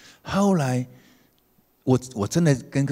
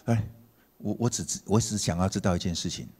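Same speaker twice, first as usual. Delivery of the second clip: normal talking voice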